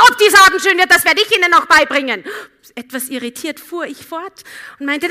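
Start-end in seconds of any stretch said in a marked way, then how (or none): 0.1-2.2 loud, high, snappish and fast; rude passenger. Retold or imitated. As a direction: bark it back at her arrogantly. Rude passenger snaps back.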